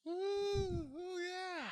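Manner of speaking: high, loud falsetto crowd ooh; mock studio audience. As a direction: ham up an audience reacting. Mocking imagined studio audience.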